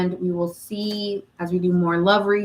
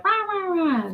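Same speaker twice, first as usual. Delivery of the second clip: high, chanted rah rah; peppy cheerleader chant; mock hype chant; 10 semitones higher than usual. Shown as a demonstration. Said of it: "that I can sound like".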